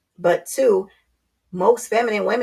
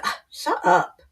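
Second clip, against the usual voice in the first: dismissive quote; slower than usual. Retold or imitated. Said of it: "they're like".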